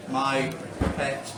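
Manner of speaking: deadpan verse recital with room for laughs; comic poetry reading. Acted. Poet confiding a pet hate to a laughing audience, comic recital. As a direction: read the poem in measured phrases, building then dropping for the punchline. Reads comic poem aloud.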